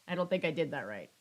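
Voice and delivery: usual speaking voice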